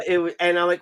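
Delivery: usual speaking voice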